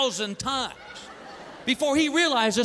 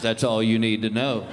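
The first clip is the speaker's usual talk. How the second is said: much lower, ominous neighbour; lowered menacing voice; 12 semitones lower than usual. Retold or imitated. Neighbour warning a visitor about his dog, ominous. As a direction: drop it low and threatening. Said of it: "And he goes".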